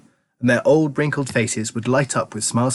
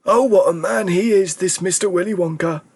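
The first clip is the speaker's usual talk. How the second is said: put-on excited old man; 7 semitones higher than usual. A narrator's reading. Grandpa Joe raving to Charlie about Wonka, high and excited. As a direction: burst with delight like an old man made young. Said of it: "cried Grandpa Joe".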